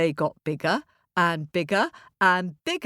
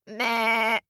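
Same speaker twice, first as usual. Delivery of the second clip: short bleat, pitched up; ram's bleat; 4 semitones higher than usual. Acted. Ram pushes back at the boy, bleating. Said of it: "and the ram pushed".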